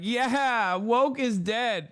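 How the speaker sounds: high, slow gleeful cheer; mock cheer. Streamer mocks Megyn Kelly's gleeful, high and slow cheer. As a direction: crow it like a smug victory. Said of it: "Megyn Kelly's like".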